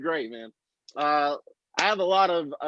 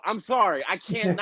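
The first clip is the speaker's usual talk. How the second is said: usual speaking voice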